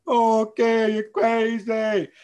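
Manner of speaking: mocking dismissal. Mimicking everyone who called him crazy, high and mocking. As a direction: sneer it like they thought you'd lost it. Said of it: "and everybody said".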